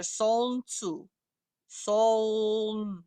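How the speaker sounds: "Soln tsu" slowly, L flowing straight into N; L-N blended. Shown as a demonstration. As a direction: stretch "Soln", sounding both L and N as one cluster. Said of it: "the L N kinda go together".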